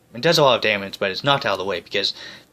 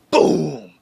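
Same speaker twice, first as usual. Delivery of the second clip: loud "Boom!" explosion sound, higher; mock explosion; 6 semitones higher, 5 dB louder than usual. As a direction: shout it like a blast going off. Sound effect after ship explodes.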